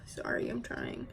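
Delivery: breathy whisper while straining. Real, not acted. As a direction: whisper it under your breath. Struggling to pull out products.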